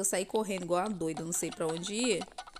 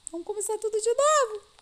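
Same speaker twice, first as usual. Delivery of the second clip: exasperated; 13 semitones higher, 5 dB louder than usual. Real, not acted. Restarting after falling.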